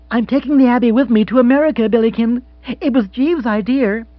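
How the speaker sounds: high, loud, excited lady; animated, lifted woman's voice; triumphant wealthy widow. Acted. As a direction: announce it with gushing pride. Voices Mrs Spottsworth in dialogue.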